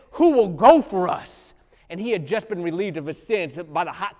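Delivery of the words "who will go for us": slow, loud, booming question; voice of God. Retold or imitated. Preacher voicing God asking Isaiah, a slow booming call. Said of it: "and he says".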